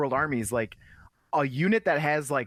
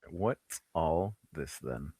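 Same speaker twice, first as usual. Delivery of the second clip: low, soft, slow British deadpan; understated British accent; 9 semitones lower, 7 dB quieter, slower than usual. Pretend. Co-host echoing the catchphrase, understated British accent. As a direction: murmur it slowly in British.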